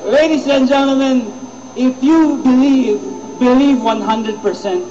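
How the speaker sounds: slow, deliberate speaker's appeal; slow down and draw them in; slow motivational address. Acted. (mabagal na pananalita ng tagapagsalita)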